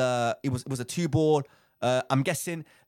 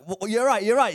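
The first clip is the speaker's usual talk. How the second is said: higher, louder worried check on a friend; retold alarm; 6 semitones higher, 6 dB louder than usual. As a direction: re-enact an alarmed, urgent check.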